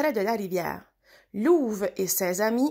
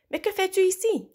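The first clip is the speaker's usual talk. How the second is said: astonished cry; 9 semitones higher than usual. A narrator's reading. Lou, astonished as Louve lands on him, cries out. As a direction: blurt it out, stunned. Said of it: "cria Lou, ébahi".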